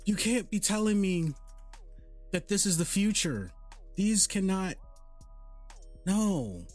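ordinary delivery

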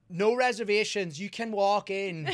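imitating a hostess loudly over-enunciating; slow, high, loud, word by word for someone not understanding. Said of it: "they get louder?".

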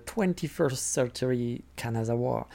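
slow, soft English with a heavy French accent; clumsy French-accented English; bad English accent. Shown as a demonstration. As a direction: drag out the English name in a poor accent. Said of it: "avec mon accent de merde".

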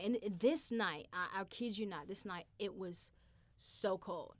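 normal talking voice

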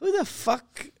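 high, incredulous reenactment; mock disbelief. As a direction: repeat it in baffled disbelief. Retold disbelief at cousin's words.

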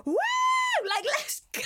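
high excited cheer; pumped whoop. Retold or imitated. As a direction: whoop like you're ready to win. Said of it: "I was like".

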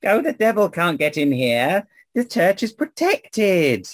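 put-on high, slow old woman voice. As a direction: play a reassuring old seamstress. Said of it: "the woman says".

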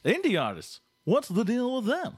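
high, loud stand-up comic impression; exaggerated observational comic, pitch leaping up; Jerry Seinfeld. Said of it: "Jerry Seinfeld".

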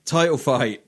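ordinary talk, nothing marked

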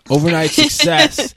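high, loud, slow mock grandeur. Real, not acted. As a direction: stretch it out like a headline. Mocking the sexy title.

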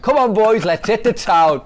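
loud, high mock shout to the lads; rally the boys for a night out, hammed-up; rousing call-out. Manager pops out of the lift to the players, rousing mock shout.

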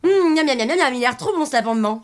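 hammed-up yummy noises; exaggerated relish. Léa praising her mother's rabbit, exaggerated relish. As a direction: make a show of savouring the food to placate. Overpraises food to placate mother.

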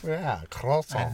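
slow, drawn-out French croissant; mock French croissant. Listing French words they know, mock French accent.

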